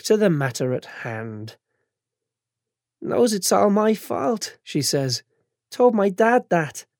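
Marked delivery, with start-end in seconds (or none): 3.0-4.6 put-on high, tearful, whiny girl; a bandaged girl fighting tears; whiny young woman. Chantal voiced. 5.7-6.9 put-on high, tearful, whiny girl; a bandaged girl fighting tears; whiny young woman. Chantal voiced.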